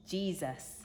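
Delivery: ordinary delivery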